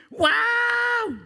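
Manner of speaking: screaming wow high and loud; hammed-up roller coaster scream; crowd shout. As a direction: yell like a terrified rider. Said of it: "Semua orang langsung bilang gini".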